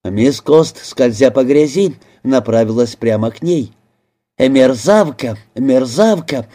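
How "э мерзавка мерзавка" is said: usual speaking voice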